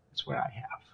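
very soft, low whisper; barely voiced, under the breath; hushed boast. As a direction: drop it to a smug whisper. Boast repeated in a hush.

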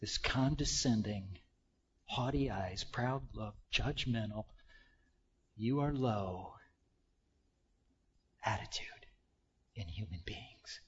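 soft, low and hushed; solemn hushed warning; hushed intensity. Acted. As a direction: drop to a grave near-whisper. Preacher describing God's hatred.

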